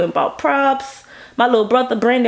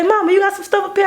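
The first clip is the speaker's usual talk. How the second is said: eager ask; 11 semitones higher, much faster than usual. Retold or imitated. Asking mom for blood supplies.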